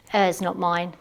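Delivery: ordinary delivery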